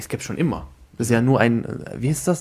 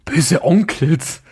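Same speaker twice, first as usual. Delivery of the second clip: disbelief; 4 semitones higher, much slower than usual. Real, not acted. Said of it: "wer hört denn Böse Onkels?".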